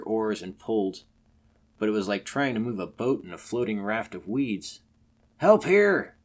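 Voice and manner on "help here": loud, high call for help; urgent cry. Acted. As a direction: yell for help to the crew. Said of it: "cried the boatman".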